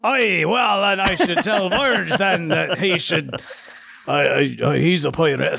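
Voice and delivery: a hint of a put-on Scottish accent, laughing; lightly mock Scottish, slowing as you chuckle; playful half-hearted Scottish brogue. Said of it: "your Scottish accent was lacking".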